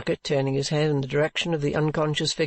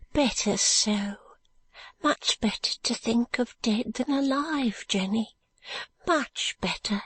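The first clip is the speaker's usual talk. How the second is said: higher, slow, bleak comfort to a friend; higher voice of a weary woman easing her friend's grief; grim consoling woman; 8 semitones higher, slower than usual. Acted. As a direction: soothe her with a hard truth. Second woman consoles Jenny.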